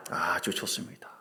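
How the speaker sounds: usual speaking voice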